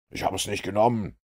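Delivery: the cornered thief denying to the detective, high and hasty; protesting thief. Acted. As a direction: blurt the denial in a hurry.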